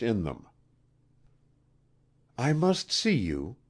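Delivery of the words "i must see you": higher-pitched queenly command. A narrator's reading. Queen to Wilson before the crowd, commanding. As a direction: make it an urgent royal order. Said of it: "she commanded".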